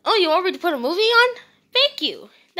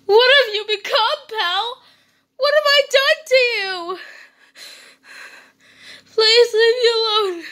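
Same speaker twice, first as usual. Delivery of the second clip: crying plea; 5 semitones higher than usual. Acted. Owner begging the frog to leave, tearful. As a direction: beg him through tears.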